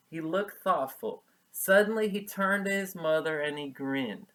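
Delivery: bright storybook reading; story narration. A narrator's reading. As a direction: read on to the class.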